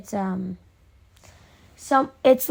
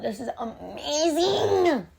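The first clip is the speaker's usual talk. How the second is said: drawn-out, high squeal of delight; gushing excitement; 5 semitones higher, 4 dB quieter, slower than usual. Retold or imitated. Fan gushing to friends, slow excited squeal. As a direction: stretch it out, rising into a squeal.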